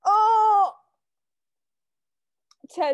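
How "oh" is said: loud high shocked oh; shocked gasp. Real, not acted. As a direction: blurt out in shock. Shocked reaction to rude message.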